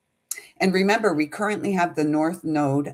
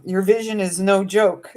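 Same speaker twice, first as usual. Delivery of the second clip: channeled message reading; 5 semitones higher, 4 dB louder than usual. Acted. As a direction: intone it like a message from spirit.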